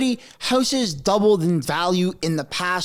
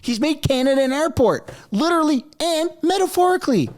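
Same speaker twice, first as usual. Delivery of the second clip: indignant rant; 9 semitones higher than usual. Real, not acted. Indignant punchline.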